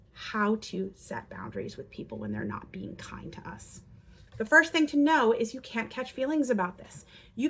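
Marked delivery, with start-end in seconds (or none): 0.1-3.8 hushed whisper. Shown as a demonstration. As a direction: lean in and whisper it. Drops to whisper mid-lesson.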